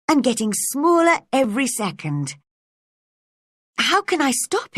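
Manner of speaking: Alice worried as she shrinks; growing worry. Acted. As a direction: let the worry climb.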